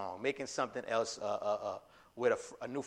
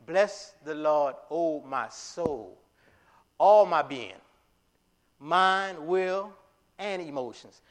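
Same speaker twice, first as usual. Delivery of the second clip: quoted hymn line; 2 semitones higher, 4 dB louder, slower than usual. Retold or imitated. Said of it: "like the song was saying".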